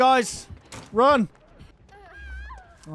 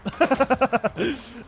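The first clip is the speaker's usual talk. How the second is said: loud surprised laugh; shocked laughter; 6 semitones higher, 4 dB louder than usual. Real, not acted. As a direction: laugh out at the miss. Laughing at the killer's miss.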